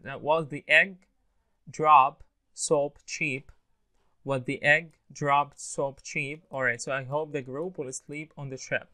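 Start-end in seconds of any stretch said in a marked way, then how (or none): 0.0-6.4 slow "drop soap cheap", each final P fully released; clear P endings. Shown as a demonstration. Teacher drilling P endings, slow and clearly finished words. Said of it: "endings يبقى clearly and".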